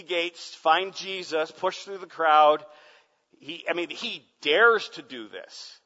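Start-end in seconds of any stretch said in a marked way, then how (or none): none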